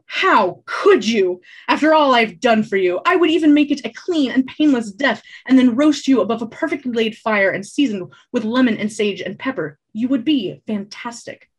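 milk the betrayal theatrically; mock wounded bard. Bard teasing the boy about roasting him, hammy.

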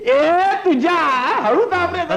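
high, pained yelp to go easy; pained cry. Acted. पाठ दाबणाऱ्याला हळू दाबायला सांगत वेदनेने ओरडणे. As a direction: yelp in pain, begging him to press gently. Pressed too hard, cries out.

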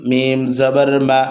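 normal talking voice

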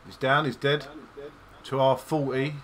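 loud, high combat callout; excited callout. Real, not acted. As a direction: call the kill to the team. Kill callout to squad.

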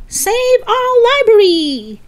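high, slow, loud chant; crowd shouting. Acted. Whole crowd answers the moving man, shouting. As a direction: roar the slogan like a whole rally. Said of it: "The whole crowd started shouting again".

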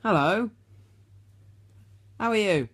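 ordinary talk

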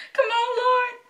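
loud, very high wailed plea; desperate pleading. Real, not acted. Fan waiting in the ticket queue, wailing a loud plea. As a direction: cry out to the Lord, high and loud.

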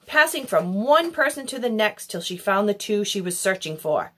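plain reading voice

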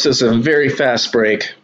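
ordinary talk

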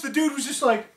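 ordinary talk, nothing marked

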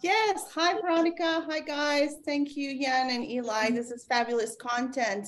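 ordinary talk

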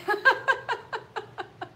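short high giggle; a little embarrassed giggle at arriving too early. Real, not acted. Laughs at being early.

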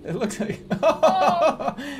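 high, slow words through laughter; laughing. Real, not acted. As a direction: talk while cracking up. Laughing at the spell.

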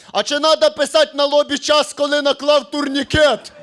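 mock heckler. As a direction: play a clueless heckler. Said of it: "мужчина в залі, е, викрикує". (придуркуватий голос чоловіка із залу)

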